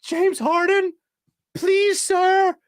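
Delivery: high whiny plea to James Harden; pleading fan. Retold or imitated. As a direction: beg Harden like a desperate fan.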